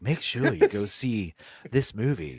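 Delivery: lightly voiced sultry invite; a hint of purring seduction; understated sexy trailer pitch; seductive voice. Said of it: "sexually entice you".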